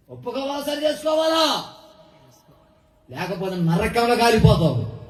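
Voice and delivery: high, climbing string of preacher's questions; fiery sermon questions pitched high; preaching at full force. Acted. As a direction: press each question harder, rising.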